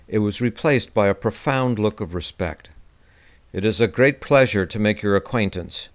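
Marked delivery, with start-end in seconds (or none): none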